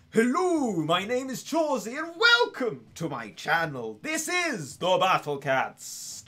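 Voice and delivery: loud, high British channel-intro voice; hammed-up British greeting, stretched at the end; Chawsey. Streamer imitates another British creator's channel intro, exaggerated English accent. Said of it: "Chawsey also British".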